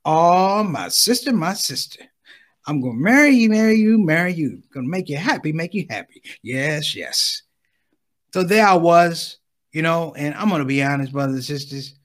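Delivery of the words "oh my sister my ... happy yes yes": woo her in doubled phrases; imitating the brother's pitch. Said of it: "I just walked to the sister".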